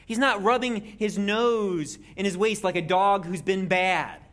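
normal talking voice

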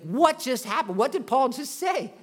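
ordinary talk, nothing marked

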